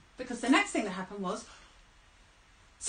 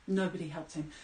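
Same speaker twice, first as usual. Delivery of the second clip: ordinary delivery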